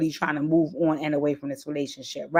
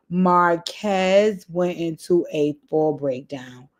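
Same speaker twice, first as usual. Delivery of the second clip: slow emphasis; much slower than usual. Real, not acted. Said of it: "slow emphatic recap".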